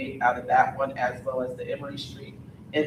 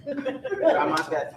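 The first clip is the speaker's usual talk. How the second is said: tired; 11 semitones higher, much slower than usual. Real, not acted. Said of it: "I'm tired".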